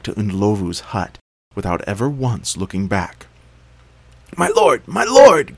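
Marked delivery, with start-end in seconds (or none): 4.3-5.5 high, loud terrified call; terrified cry. A narrator's reading. Jackal fleeing back to the king, terrified cry. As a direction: scream for your master. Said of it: "cried Mpungushe".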